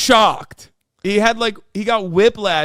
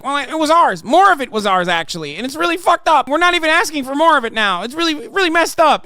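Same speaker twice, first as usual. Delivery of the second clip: high whiny mock complaint, partly fast; hammed-up whining like a crybaby; mock whiny crying; 8 semitones higher, faster than usual. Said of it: "he's literally crying".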